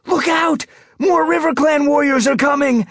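very high, loud warning cry; shrill, panicky shouted warning; shrill anxious yowl. A narrator's reading. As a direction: yowl the alarm across a battlefield. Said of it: "A warning yowl came from the shore, shrill and anxious".

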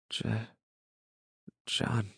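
quiet, halting recollection; hesitant. A narrator's reading. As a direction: grope for the name with a long pause. Child struggles to recall name.

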